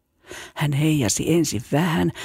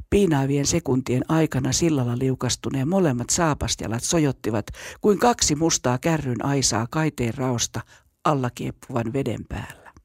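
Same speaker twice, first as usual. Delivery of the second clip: ordinary delivery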